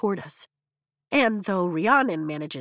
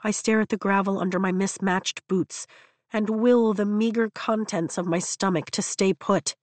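plain reading voice

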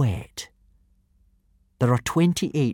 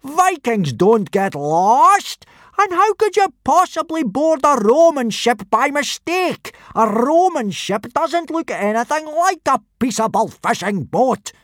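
angry booming; 7 dB louder than usual. Acted. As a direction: bellow the scolding. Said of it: "boomed Gobber".

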